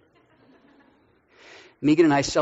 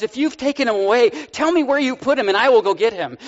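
higher, urgent plea; Mary pleading with the gardener, urgent and raised; 7 semitones higher, 5 dB louder than usual. Acted. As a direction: beg to know where he is. Mary's pleading reply.

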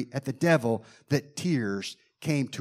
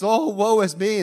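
higher, lightly hammed-up lament; mock woe; 5 semitones higher, 4 dB louder than usual. Shown as a demonstration. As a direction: sigh it like a mock mourner. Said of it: "The other one's".

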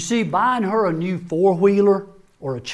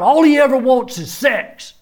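higher, louder outburst as the wife; blurted out; 7 semitones higher, 5 dB louder than usual. Retold or imitated. Wife in counseling blurting out her complaint, loud and high. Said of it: "but then she blurted out".

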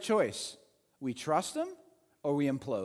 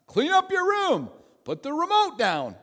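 higher barked orders; officer ordering soldiers around; commanding officer; 6 semitones higher, 3 dB louder than usual. Retold or imitated. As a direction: snap out commands like a drill officer. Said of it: "what commanding officers tell soldiers".

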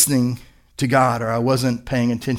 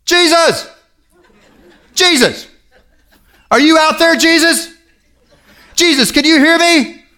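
put-on high child's voice calling up to the sky; little boy calling out; 5 dB louder than usual. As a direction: call out to the stars like a small boy. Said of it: "he goes".

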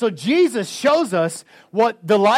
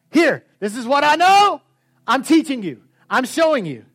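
a fatherly teacher, raised up, eager to share; God's voice, higher and louder; 5 semitones higher, 6 dB louder than usual. Retold or imitated. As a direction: speak as God explaining what He knows. Voicing God teaching.

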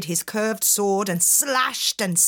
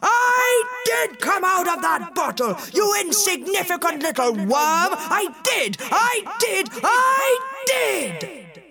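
genie roaring; 10 semitones higher than usual. Acted. Genie insists to the fisherman he came from the bottle, building roar. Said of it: "The genie roared again".

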